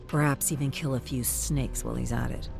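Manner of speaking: plain reading voice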